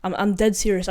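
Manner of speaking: ordinary talk, nothing marked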